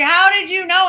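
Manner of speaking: high, loud astonished ask; girl demanding how he knew: high, loud amazement; stunned question. Retold or imitated. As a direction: gasp it out, amazed. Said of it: "she's like".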